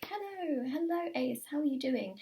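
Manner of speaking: high-pitched playful cooing; playful greeting. Pretend. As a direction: coo a mock-flirty hello, starting very high. Playfully greets a drawn card.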